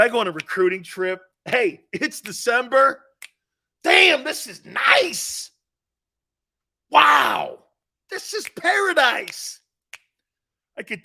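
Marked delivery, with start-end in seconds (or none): 1.5-9.6 high, slow delight at paradise; awed newcomer. Retold or imitated. New recruit marvels at warm South Florida, high and slow. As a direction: gush about the weather, wide-eyed. First trip, awed by the weather.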